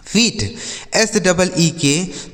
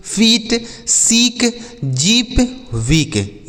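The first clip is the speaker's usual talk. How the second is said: drawn-out reading; 5 semitones higher, much slower than usual. Shown as a demonstration. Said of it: "teacher reviews the word list".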